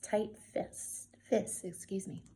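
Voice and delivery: whispering. Acted. Whispered reading intro.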